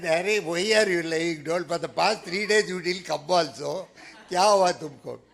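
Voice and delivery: loud, exasperated telling-off; loud scolding. Retold or imitated. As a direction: shout at the lazy disciple. Saint scolds lying disciple.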